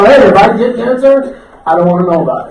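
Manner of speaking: loud, comic brush-off; loud interruption. Retold or imitated. Tea drinker silencing a friend's cancer warning, loud brush-off. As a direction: cut the friend off at full volume.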